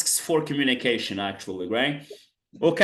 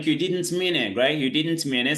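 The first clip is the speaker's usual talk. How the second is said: normal talking voice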